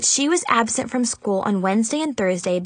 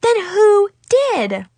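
high, loud, drawn-out question; stunned, stretched-out outburst; gasped; 11 semitones higher, 5 dB louder, slower than usual. A narrator's reading. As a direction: demand it in shocked high pitch. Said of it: "Chloe and Zoe gasped".

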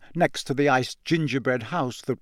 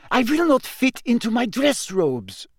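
put-on grumpy, high French-accented complaint; high-pitched grumbling French girl; Fleur Delacour; 10 semitones higher than usual. A narrator's reading. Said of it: "they heard her saying grumpily".

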